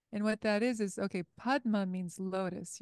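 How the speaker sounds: ordinary talk, nothing marked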